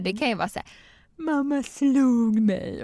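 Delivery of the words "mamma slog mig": put-on slow, higher pouty child voice; accusing toddler. Toddler accusing mother, slow and higher. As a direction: draw it out like a sulking kid.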